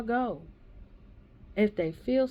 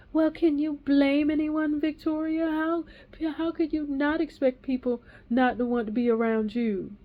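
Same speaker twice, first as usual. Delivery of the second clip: higher, louder, mock-prissy voice; mocking prim voice; 7 semitones higher, 4 dB louder than usual. Mimicking the woman who blamed her, prissy and mocking. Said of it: "she was like".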